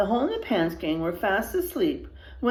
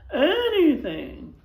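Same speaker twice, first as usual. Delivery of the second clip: desperate plea; 8 semitones higher, 5 dB louder, slower than usual. Acted. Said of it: "pleaded the sheriff".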